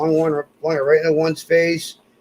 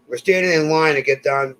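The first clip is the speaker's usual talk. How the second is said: ordinary delivery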